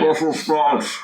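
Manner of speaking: put-on muffled old grandfather voice; hand over mouth. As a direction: talk through a hand covering the mouth. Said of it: "my grandfather voice with my hand over my mouth".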